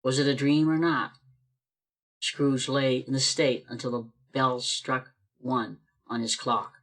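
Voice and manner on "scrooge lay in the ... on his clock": plain reading voice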